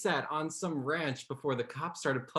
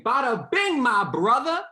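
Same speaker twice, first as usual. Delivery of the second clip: high, loud hammed-up mock Seattle accent; 7 semitones higher, 9 dB louder than usual. Shown as a demonstration. Dialect coach showing a Seattle proposal, loud mock accent. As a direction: belt out a bogus Seattle accent. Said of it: "Speaking of Seattle".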